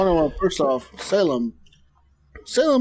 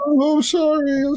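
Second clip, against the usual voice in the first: high, bright mock sorry, a bit louder; mock apologetic driver; 11 semitones higher, 4 dB louder than usual. Lost driver apologizing mid U-turn, mock high sorry. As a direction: imitate a flustered driver apologizing, pitched way up. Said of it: "They're like".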